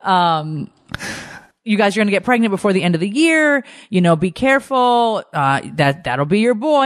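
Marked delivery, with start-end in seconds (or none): 1.6-6.8 psychic voice. Retold or imitated. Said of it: "quoting Rose again".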